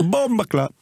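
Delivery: high mock Jamaican exclamation again; Jamaican patois again. Teasing repeat of Philly's Jamaican curse. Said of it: "Doesn't say anything else, do you?".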